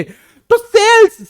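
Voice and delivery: Sales sung out slow and high; drawn-out sales. Shown as a demonstration. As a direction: drag out sales like announcing the answer. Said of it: "teacher stresses the key term".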